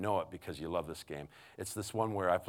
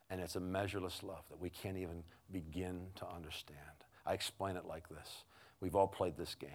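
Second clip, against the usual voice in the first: ordinary talk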